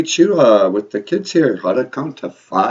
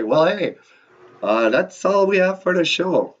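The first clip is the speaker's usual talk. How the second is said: normal talking voice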